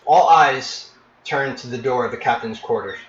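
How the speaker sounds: normal talking voice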